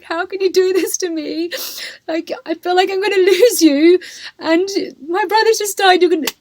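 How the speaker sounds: voicing crying sister. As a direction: play the sister in tears. Sister bursts into tears.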